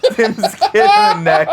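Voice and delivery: laughing. Real, not acted. Retelling absurd plan, laughing.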